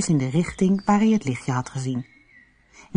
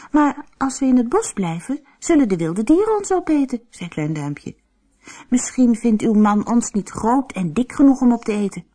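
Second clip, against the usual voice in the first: put-on young boy voice; 7 semitones higher than usual. A narrator's reading. Boy pleads with giant's wife.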